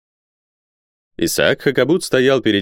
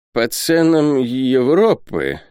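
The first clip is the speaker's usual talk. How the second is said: slow, raised, drawn-out grumble; grumbling through teeth; 6 semitones higher, much slower than usual. A narrator's reading. Isaac reacting to European prices, grumbling. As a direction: echo it begrudgingly through the teeth. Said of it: "пробормотал сквозь зубы Исаак Хакабут".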